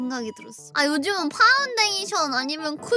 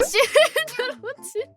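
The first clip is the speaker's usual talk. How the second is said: words through a laugh; giggling, slowed down; laughing while talking; 8 semitones higher, 3 dB louder, slower than usual. Real, not acted. Laughing at shield game.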